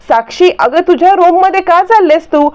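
high-pitched mock shout meant to warn; loud call. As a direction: call out her name so the next room hears. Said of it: "असं मोठ्याने ओरडायला लागते".

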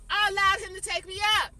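heated. Real, not acted. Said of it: "I'm hot".

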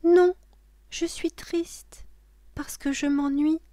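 put-on very high child voice; sad little girl. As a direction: complain as a bored small child. Lola's sad answer to the witch.

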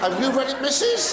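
a naughty, tittering tease; cheeky giggling squeal. Real, not acted. Comic teasing a woman in the crowd, giggled high. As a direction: leap up high and laugh through it.

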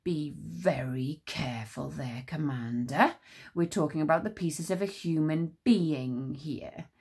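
a grave voice urging caution; low cautioning voice. Acted. Different voice, low and slow.

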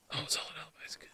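whispered aside. Real, not acted. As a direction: whisper it to yourself. Muttering about the game.

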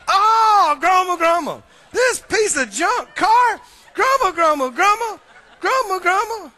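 loud, high grumbling about the car; mock grouch griping about a junk car. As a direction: ham up a frustrated car owner.

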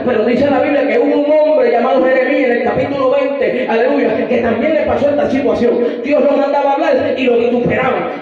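loud, driving sermon delivery; fiery preaching. Acted. Preacher telling the church about Jeremiah, full-force preaching.

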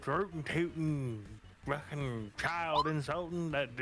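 lightly voiced cranky old man. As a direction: rant like a grumpy grandpa. Grumpy grandpa rant.

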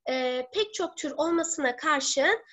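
ordinary talk

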